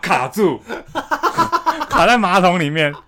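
slow and loud, breaking into laughter; can't get the words out for laughing; telling a friend about getting stuck in the toilet, laughing hard. Real, not acted. Laughing at own toilet mishap.

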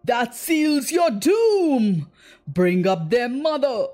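villain captain's drawn-out roar. A narrator's reading. Captain condemning the defiant boys, roaring. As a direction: roar the sentence of doom. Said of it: "Hook roared out".

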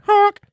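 one high loud honk; silly clown honk. Acted. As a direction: blurt a goofy honk. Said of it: "I honk habitually".

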